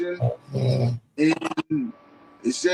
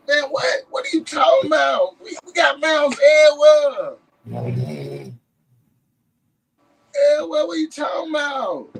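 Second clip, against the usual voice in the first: indignant disbelief; 7 semitones higher, faster than usual. Real, not acted. Indignant disbelief about mounds.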